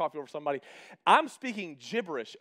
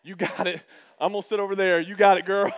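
louder, hands-off reassurance; loud mock backing off; 7 dB louder than usual. Husband backing away from his laboring wife, loud. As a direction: cheer her on from a distance.

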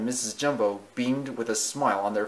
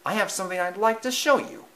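mouse voice; 9 semitones higher, faster than usual. Acted. Timothy voiced.

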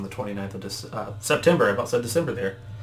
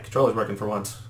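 normal talking voice

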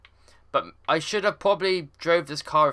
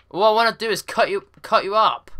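aggressive; 7 semitones higher, 5 dB louder than usual. Real, not acted. Trying to ram another car.

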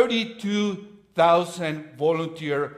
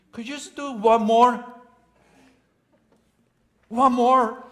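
loud, high pleading for one more; begging loudly; 9 semitones higher, 9 dB louder than usual. Acted. Gideon begging God for one more sign, loud and high. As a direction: push the plea up high and loud.

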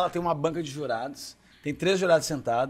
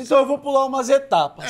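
higher, louder decisive judge voice; brisk judge; 9 semitones higher, 7 dB louder than usual. Acted. Miguel telling the candidate he skips steps, high and loud. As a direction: cut straight to the point like a director.

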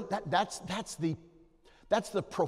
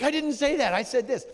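put-on defensive little boy; 10 semitones higher, 8 dB louder than usual. Little boy voiced defending himself.

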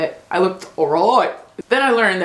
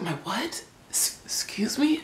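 baffled reply; 2 semitones higher, 10 dB quieter than usual. Retold or imitated. Acted baffled reply to accent.

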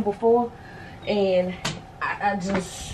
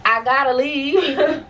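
laughing; 7 semitones higher, 4 dB louder, slower than usual. Real, not acted. Laughing through a sad admission.

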